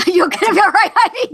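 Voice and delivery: words squeezed out high through real laughter; can barely speak for laughing. Real, not acted. To her friend choking on coffee, laughing hard and high.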